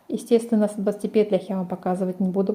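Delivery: usual speaking voice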